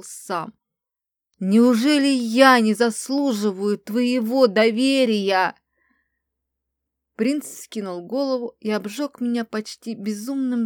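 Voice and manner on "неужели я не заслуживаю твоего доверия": loud, slow angry outburst; helpless anger. A narrator's reading. Prince demands trust from the woman he loves, helplessly angry. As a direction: voice breaking with powerless fury. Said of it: "срывающимся от бессильной злости голосом".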